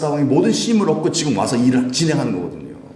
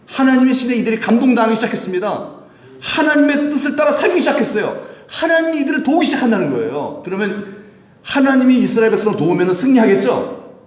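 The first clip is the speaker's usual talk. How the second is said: high, impassioned sermon delivery; full-force preaching; 10 semitones higher, 4 dB louder than usual. Real, not acted. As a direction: preach it out to the congregation, voice raised high. (높은 목소리로 힘주어 외치는 설교)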